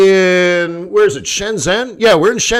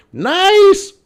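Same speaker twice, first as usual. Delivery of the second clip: much higher, louder; triumphant nice; 14 semitones higher, 5 dB louder than usual. Retold or imitated. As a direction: squeak it in delight. Triumphant after saving show.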